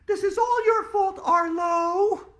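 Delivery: very high, loud accusing shriek; furious yell. Acted. Andrea blaming Arlo for the fiasco, shrill furious yell. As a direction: scream the blame at him. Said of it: "Andrea yelled".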